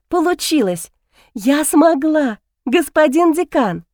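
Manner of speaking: high, joyful cry of triumph; shrieking jackpot winner. Acted. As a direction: shout it like you just won. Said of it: "закричала от радости".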